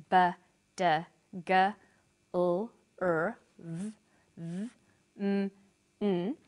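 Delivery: isolated voiced consonants with a pause between each; voiced consonant sounds. Shown as a demonstration. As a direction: say "b, d, g, l, r, v, m, n" as sounds, not letter names. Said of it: "after voiced consonants such as".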